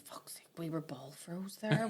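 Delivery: hushed retold whisper to her sister; whispered aside. Mum whispering to aunt on the bus, hushed whisper. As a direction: mum muttering low so the child won't hear.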